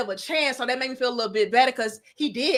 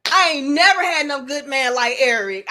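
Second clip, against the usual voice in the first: normal talking voice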